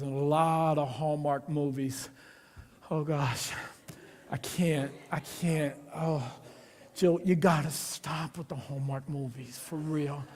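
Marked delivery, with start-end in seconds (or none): none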